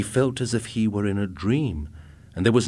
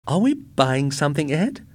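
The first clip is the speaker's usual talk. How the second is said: high, puzzled wife asking; baffled by the robot; confused question; 8 semitones higher, 4 dB louder than usual. A narrator's reading. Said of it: "she asked, confused".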